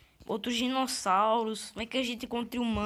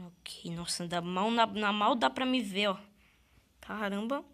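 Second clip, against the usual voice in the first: usual speaking voice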